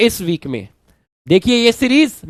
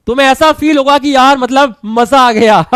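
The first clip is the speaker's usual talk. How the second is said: excited laugh; 3 semitones higher than usual. Real, not acted. Excited promise, then laughs.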